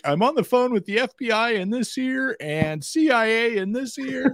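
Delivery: high, put-on voice, busy and urgent; mock self-important caller. Mocking someone who goes to extremes over a phishing email. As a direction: play the man juggling the FBI and CIA.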